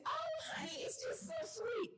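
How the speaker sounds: churchgoer gushing. Retold or imitated. Churchgoer outside church praising the sermon, high and gushing. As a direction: gush it like a church lady after service. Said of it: "And we say".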